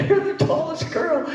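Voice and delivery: high, slow, laughing imitation of the team; mimicking the coaches begging her to join; high laughing mock coaxing. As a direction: laugh it out in a squeaky pleading voice. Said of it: "And they were like".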